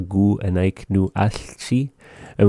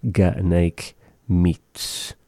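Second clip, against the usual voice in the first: slow; 3 dB quieter, much slower than usual. Shown as a demonstration. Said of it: "teaching pronunciation".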